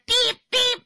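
high loud beep beep; censor bleeps. Retold or imitated. Telling the mother-in-law's insult, loud high bleeps.

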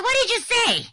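high loud disbelieving what did you say; shocked question. Retold or imitated. Host asking her mother-in-law the insult, shocked high loud question.

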